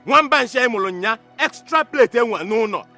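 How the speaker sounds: loud, high-pitched rant; angry tirade. Acted. Patrick complaining to parent about unfortunate child, angry tirade. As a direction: rail indignantly at the parent.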